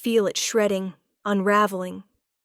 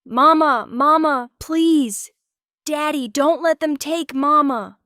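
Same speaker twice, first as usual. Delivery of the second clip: put-on high, much louder child's scream; 8 semitones higher, 8 dB louder than usual. A narrator's reading. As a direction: wail for mama desperately. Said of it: "My daughter's screams".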